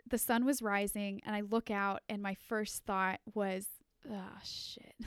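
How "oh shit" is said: hushed horrified whisper; whispered dread. Retold or imitated. Whispering her first thought on Easter morning, horrified. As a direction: breathe it out in quiet dread. Waking to see the prank.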